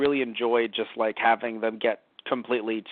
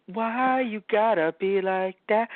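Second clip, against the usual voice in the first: whiny complaint; 7 semitones higher, slower than usual. Real, not acted.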